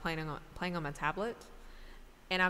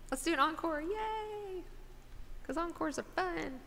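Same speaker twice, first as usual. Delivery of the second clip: excited encore cheer; 12 semitones higher than usual. Real, not acted.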